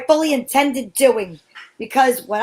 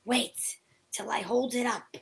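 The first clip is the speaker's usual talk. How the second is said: whisper; 4 semitones lower, 13 dB quieter than usual. Acted. Whispering at the crime scene.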